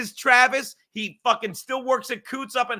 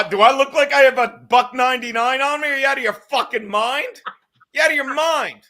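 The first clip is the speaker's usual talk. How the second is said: raised, incredulous comic rant; mock outrage; 3 semitones higher, 6 dB louder than usual. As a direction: loudly protest as if insulted. Mock outrage at friend's question.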